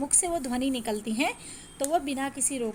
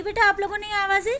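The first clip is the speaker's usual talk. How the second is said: eager check-in; 8 semitones higher, 6 dB louder, faster than usual. Real, not acted. Teacher checking on pupils.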